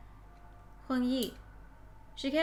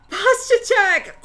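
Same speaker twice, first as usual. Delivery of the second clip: very high, loud, drawn-out shout; shouted call-out; 12 semitones higher, 15 dB louder, much slower than usual. Real, not acted. Streamer interrupts reading to alert chat, high drawn-out shout. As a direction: belt it out slowly like an alarm.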